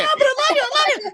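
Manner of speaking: very high, giddy mock Anna voice; Anna quote. Brother mocks Anna gushing over her fiancé, in a very high giddy voice. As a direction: squeal it like a lovestruck girl.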